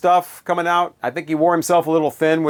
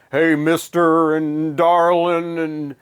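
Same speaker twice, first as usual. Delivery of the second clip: slow, drawn-out mock [name] delivery; [name] talking voice; 3 semitones higher, slower than usual. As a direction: lazily spin it out like the Boss on a record. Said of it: "this way he talks about".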